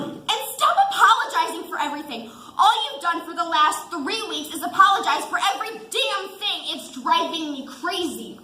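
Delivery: loud, fast exasperated rant; frustrated tirade. Acted. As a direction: fire the complaints at him. Wife's frustrated tirade continues.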